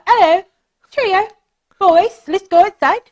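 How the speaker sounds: high-pitched, hammed-up British accent; bad British accent. Shown as a demonstration. Viewer parrots a British dating show, bad British accent. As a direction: do a silly mock-British chirp. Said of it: "like, a bad British accent".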